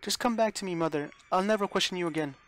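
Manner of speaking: high, loud begging between sobs; sobbing plea. Acted. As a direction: beg Mother not to be angry. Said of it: "Rosa sobbed with grief".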